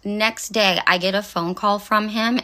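ordinary talk, nothing marked

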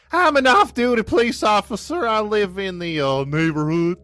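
high, loopy mock-Southern voice; put-on Southern twang; Southern accent. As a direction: play a high-pitched Southern cop. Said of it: "you don't talk Southern".

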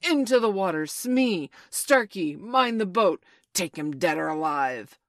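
urgent captain's bellow; shouted orders. A narrator's reading. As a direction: shout orders across the water. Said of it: "Hook shouted".

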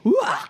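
high, loud yelp of pain; retold scream. Mom reenacting her bite, sharp high yelp. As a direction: yelp like you just got bitten. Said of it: "I scream out".

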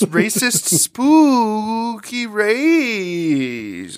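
very drawn-out, high swooping mock-spooky wail; playful haunted-house wail; spooky ghost voice. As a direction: stretch the words like a hammy ghost. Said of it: "In the ghostiest way as possible".

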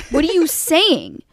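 loud exasperated question, half laughing; retold disbelief with a laugh breaking in; getting mad. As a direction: demand it, fed up. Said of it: "I was getting so mad".

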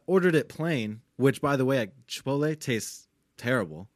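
ordinary talk

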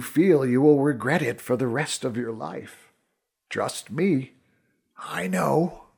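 spend your last breath on this; dying last words. A narrator's reading. Dying archer's last advice.

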